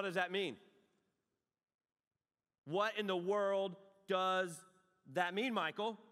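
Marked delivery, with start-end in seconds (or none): none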